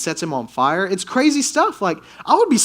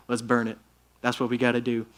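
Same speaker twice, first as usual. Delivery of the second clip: mock caveman voice; 6 semitones lower, 7 dB quieter, faster than usual. Old Testament folk deciding to burn.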